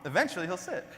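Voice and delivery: ordinary delivery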